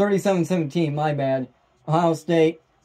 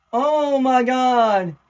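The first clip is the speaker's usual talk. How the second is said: slow, drawn-out amazement, pitched up; high, slow and astonished; stunned disbelief; 5 semitones higher, much slower than usual. Real, not acted. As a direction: stretch it out in awe after a huge play. Stunned after the touchdown.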